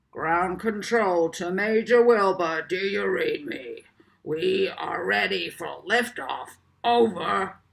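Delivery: a crackly loudspeaker voice; ground control over loudspeaker. A narrator's reading. Mission control calls the pilot over the speaker, crackly radio voice. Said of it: "A deep voice crackled over the loudspeaker".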